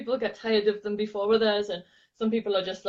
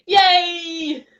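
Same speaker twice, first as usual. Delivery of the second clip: high, loud yay; cheer; 9 semitones higher, 7 dB louder than usual. Shown as a demonstration. Teacher showing the thumbs-up finish, high cheer. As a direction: throw it up like a celebration. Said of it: "thumbs up in the air".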